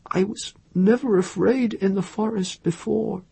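high, thin voice of dawning terror; thin, dry voice. A narrator's reading. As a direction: let the voice go thin and high with fear. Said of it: "Her voice went thin and dry".

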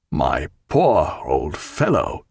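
low, slow, tender greeting; tender sympathy. A narrator's reading. Jack greeting injured friend, low and sympathetic. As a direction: drop low and draw it out gently.

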